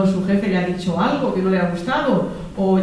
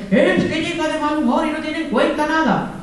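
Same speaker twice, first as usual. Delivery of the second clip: higher, aggrieved complaint; mock indignant wife; 8 semitones higher than usual. Resentful wife complaining about her moody husband. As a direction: whine about him in a put-upon tone.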